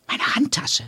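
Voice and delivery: a sudden startled whisper; whispered thought. A narrator's reading. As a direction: breathe it out as a panicked thought. Erschrockener Gedanke nach dem Überfall. (erschrocken geflüstert)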